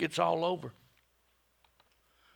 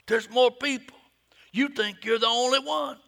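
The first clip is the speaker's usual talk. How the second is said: God's voice swelling, higher and louder; voice of God rising; 9 semitones higher, 9 dB louder than usual. Acted. God booming at Elijah, higher and louder. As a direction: make the rebuke ring out.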